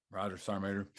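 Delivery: low, subdued acknowledgement; retold meek compliance. Soldier accepting an order, low and subdued. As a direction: answer softly, resigned to the order.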